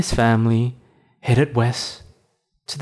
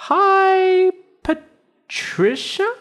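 high, slow and unsure guess; unsure bunny voice; 3 dB louder, much slower than usual. Acted. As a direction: guess the name hesitantly.

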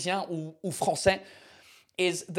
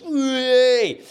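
high, loud playful "Whee!"; a silly little squeal of fun; mock cheer; 7 semitones higher, 8 dB louder than usual. Cheering about champagne at Christmas, high and loud.

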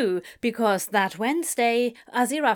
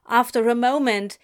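normal talking voice

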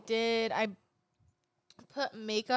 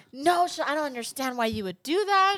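raised, louder mock scolding; yelling mom, imitated; 5 semitones higher, 5 dB louder than usual. Mom who cannot understand the shaved eyebrows, mock yelling. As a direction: imitate an exasperated mother telling you off. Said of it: "she was all yelling at me".

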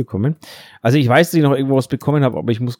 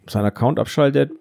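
ordinary talk, nothing marked

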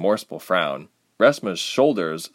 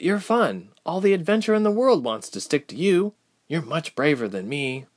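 compliment a friend sincerely; Ilse, admiring; 8 semitones higher than usual. A narrator's reading. Ilse cheering up Reshma, admiring.

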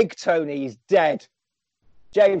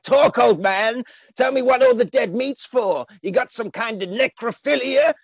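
menacing villain; 6 semitones higher than usual. Acted. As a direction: lean on him like a hammy gangster. Villain Leach threatens old man.

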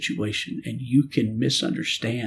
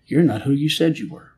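louder, higher, reproachful; man retelling how he yelled at God, louder and higher; retold accusation; 4 semitones higher, 6 dB louder than usual. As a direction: fling the charge upward. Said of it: "I started yelling at God".

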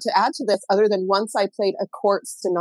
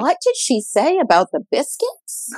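slow, higher-pitched puzzled question; baffled viewer; 6 semitones higher, slower than usual. Retold or imitated. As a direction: imitate a viewer straining to understand. Acts out baffled viewer of baking show.

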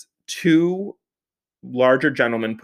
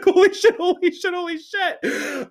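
panicked, then fading. Retold or imitated. Car stuck angled down hill.

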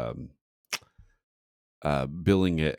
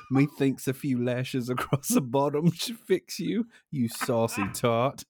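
high-pitched mock British reprimand; British accent; 9 semitones higher than usual. Reading a meme about lashes, mock British accent. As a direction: ham up the British accent, stretching the final insult. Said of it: "try to say it in a British accent".

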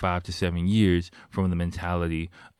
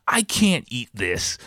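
slow, raised mock man-child voice; whiny man-child; 5 semitones higher, slower than usual. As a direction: drag out a childish complaint. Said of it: "full man child".

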